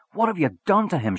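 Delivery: high, loud accusation; glaring at him, demand an answer; accusing cry. Acted. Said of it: "she cried".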